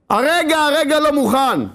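high, drawn-out protest; adamant refusal. Acted. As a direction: refuse insistently, stretching the words high. Penitent refuses to give in.

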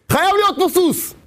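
high, impassioned sermon voice; fervent preaching. Acted. Preacher at full force.

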